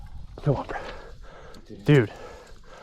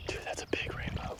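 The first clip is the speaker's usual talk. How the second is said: awed whisper; breathless hushed whisper; 18 dB quieter than usual. Real, not acted. Whispering at the catch.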